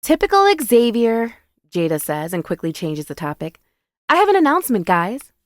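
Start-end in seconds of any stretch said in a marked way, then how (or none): 0.0-1.4 high, louder character voice; exasperated female friend. A narrator's reading. Jada voiced, teasing her friend. 4.1-5.3 high, louder attention-getting call; announcing to friends. A narrator's reading. Jada voiced, announcing to the table.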